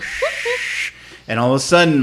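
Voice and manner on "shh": long whispered shh; shushing hiss. Retold or imitated. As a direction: hiss it like rushing noise in the ears. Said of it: "That's what I heard".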